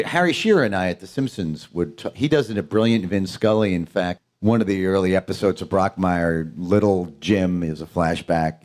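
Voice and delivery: ordinary talk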